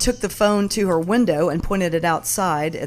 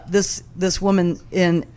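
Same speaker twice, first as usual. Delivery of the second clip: ordinary delivery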